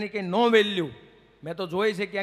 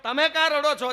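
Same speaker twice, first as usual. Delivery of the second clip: higher, whiny patient voice; a sufferer protesting, pitched up; higher, whining patient asks the doctor why he is crying; complaining patient; 6 semitones higher than usual. Acted.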